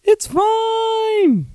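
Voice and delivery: absurdly high, drawn-out falsetto; little high voice. Acted. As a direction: pretend it's okay in a squeaky voice. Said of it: "I do that little voice thing".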